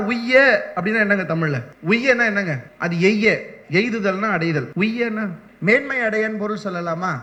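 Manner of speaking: ordinary delivery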